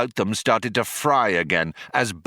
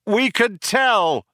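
high, drawn-out shout back; 12 semitones higher, 3 dB louder, slower than usual. A narrator's reading. Ford answers the apologetic cop, shouted. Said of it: "shouted Ford".

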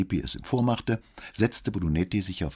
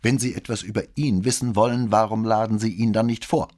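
louder, higher testy challenge; irritated; 4 semitones higher, 6 dB louder than usual. A narrator's reading. Boy objecting to questions about his father, irritated. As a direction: push back at the questioner, annoyed. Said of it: "fragte der Junge gereizt".

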